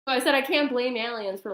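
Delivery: usual speaking voice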